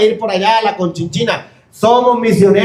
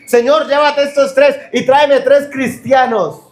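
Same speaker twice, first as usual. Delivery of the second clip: slow, louder mock prayer; praying loudly; 8 semitones higher than usual. Preacher acting a prisoner praying loudly in his cell. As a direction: cry out the prayer to heaven. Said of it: "él oraba duro".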